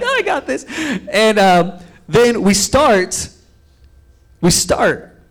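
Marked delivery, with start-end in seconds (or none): 0.0-0.7 very high-pitched cocky aside; hammed-up self-assurance, pitched way up; mock confidence. Rider getting onto the spin bike, mock cocky.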